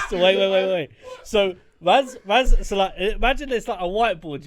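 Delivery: loud, high, excited storytelling. Real, not acted. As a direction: build it up, loud and eager. Excited retelling.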